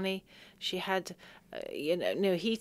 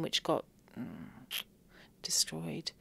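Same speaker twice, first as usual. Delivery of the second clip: choked whisper; 4 semitones lower, 6 dB quieter, slower than usual. Real, not acted. Telling of the destroyed hospital, a real choked whisper. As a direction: whisper it, barely holding back tears.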